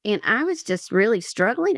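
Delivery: normal talking voice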